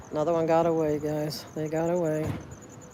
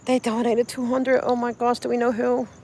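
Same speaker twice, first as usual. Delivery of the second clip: surprised; 5 semitones higher than usual. Real, not acted. Viewers just donated money.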